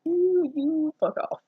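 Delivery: high, slow put-on voice, laughing; mock Satan throwing the reader out. Satan voiced to kick out the fanfic reader.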